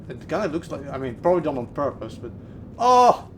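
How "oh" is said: sudden loud oh; startled. Real, not acted. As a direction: jump at the sight. Startled by spider.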